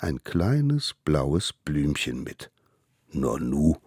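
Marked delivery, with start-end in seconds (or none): 3.1-3.8 low, lightly voiced mammoth asking; a hint of a puzzled beast; curious mammoth. Mammoth sniffs the flower, lightly low and curious. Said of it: "fragte das Mammut".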